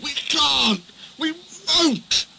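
high, strained whining refusal; stubborn trucks. A narrator's reading. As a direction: whine it like old trucks that refuse to budge. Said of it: "the trucks groaned".

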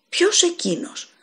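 high, slow, astonished ask; a stunned, drawn-out question. Acted. Landlord asks the sick stranger who he means, astonished. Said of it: "ρώτησε κατάπληκτος".

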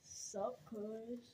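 low mumbly voice for a prop. Pretend. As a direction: make the decoration talk, low. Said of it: "stop making them talk".

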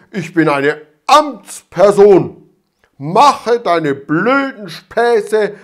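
loud, slowed ranting of an offended official; furious outburst. A narrator's reading. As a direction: shout each insult in a rage. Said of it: "bekam einen Wutanfall".

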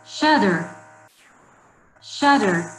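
"Shatter" said as a clear model for repeating; model pronunciation. Shown as a demonstration. Teacher has pupils repeat the word, clear model pronunciation. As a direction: model "Shatter" firmly, with a falling tune. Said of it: "Repeat please the word with me".

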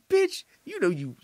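high-pitched mocking voice; mock jock retort. Jock snapping at his girlfriend, high mocking retort. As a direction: sneer it up high. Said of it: "It's like".

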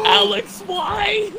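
high-pitched panicked plea; alarmed wail. Real, not acted. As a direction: wail it at a friend who caused the trouble. Blames teammate during bat attack.

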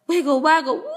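ordinary delivery, nothing marked

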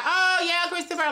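playing dumb and sweet on the phone; sweet innocent act.

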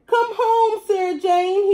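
very high, loud dog howl; howling cry. A narrator's reading. Lonely dog howling for the cat at the door. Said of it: "he cried".

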